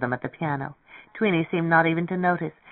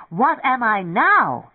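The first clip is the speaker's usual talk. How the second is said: high, slow wail; frantic cry; 6 semitones higher, 4 dB louder, slower than usual. A narrator's reading. Lemming cries from under the piano, frantic. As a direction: wail it out, stretched and soaring.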